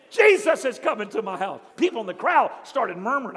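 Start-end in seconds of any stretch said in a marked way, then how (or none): 0.1-1.6 laughing joy. Acted. Zacchaeus laughing happily.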